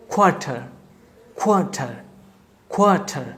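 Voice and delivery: "কোয়ার্টার" with the r sounds dropped; silent r. Shown as a demonstration. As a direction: say "কোয়ার্টার" as khwata. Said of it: "আরটাকে আমরা উচ্চারণ করবো না". ("কোয়ার্টার" র উচ্চারণ ছাড়া)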